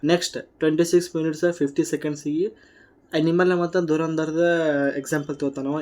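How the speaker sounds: normal talking voice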